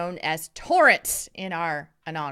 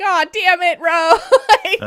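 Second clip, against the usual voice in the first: laugh-crying; 10 semitones higher, 11 dB louder than usual. Retold or imitated. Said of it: "But it's like".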